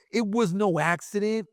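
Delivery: usual speaking voice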